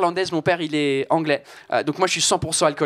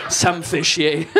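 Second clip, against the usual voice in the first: fed up; 2 semitones lower, slower than usual. Shown as a demonstration.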